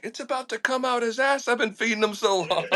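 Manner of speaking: high, frantic mock protest; retold exasperated panic. Retelling his reply to the captain while feeding line, frantic mock protest.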